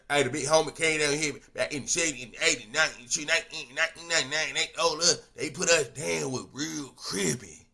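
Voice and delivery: excited imitation of a homie boasting; mock gangster boast. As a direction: ham up the hyped gangbanger bragging. Mocking a gang member's boast.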